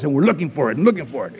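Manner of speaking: normal talking voice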